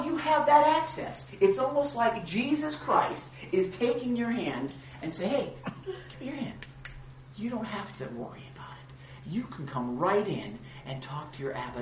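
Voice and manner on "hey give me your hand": tender invitation. Acted. Jesus voiced reaching to listener.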